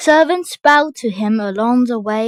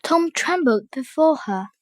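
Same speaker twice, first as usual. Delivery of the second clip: ordinary delivery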